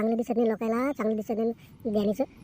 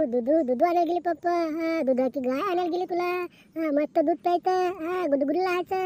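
lilting, higher-pitched cooing; baby talk; 6 semitones higher, 4 dB louder than usual. Acted. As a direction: coo to hush a fussing baby.

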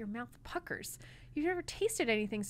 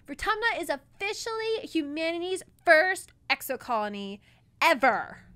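excited; 8 semitones higher, 11 dB louder than usual. Acted. Mars proud announcement.

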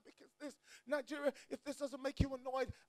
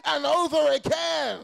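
loud, chanted preacher's climb over the music; sing-song preaching; 3 semitones higher, 10 dB louder than usual. Acted. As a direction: ride the organ to the peak. Preaching at full force, sing-song.

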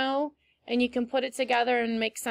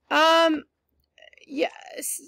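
whisper; 4 semitones higher than usual. Real, not acted. Soft answer to child.

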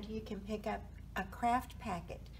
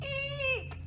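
high-pitched squeak of fright; tiny startled mouse cry; 5 dB louder than usual. A narrator's reading. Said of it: "Mouse squeaked".

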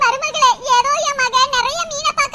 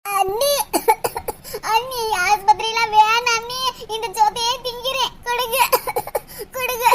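put-on pained girl with bone in throat; 7 semitones lower, 3 dB quieter than usual. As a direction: beg weakly, voice low and hurting. Same girl pleading with sister-in-law. (தொண்டை வலியில் தாழ்ந்த குரலில் கெஞ்சும் பெண்)

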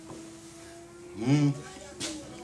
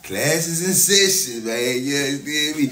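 louder, higher, slow proclamation; drawn-out announcement; 5 semitones higher, 6 dB louder than usual. Acted. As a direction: stretch it out like a hype man. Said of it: "repeats announcement, bigger".

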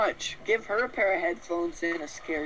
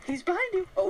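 usual speaking voice

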